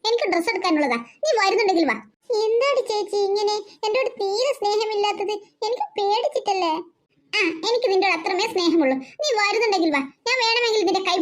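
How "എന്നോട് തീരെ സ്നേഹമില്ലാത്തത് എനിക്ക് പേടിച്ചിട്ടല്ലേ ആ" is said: ordinary talk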